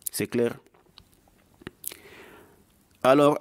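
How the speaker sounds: ordinary talk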